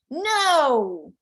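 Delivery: lightly acted child's outburst; child's firm refusal. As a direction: protest like a small kid. Child shouts back at monster.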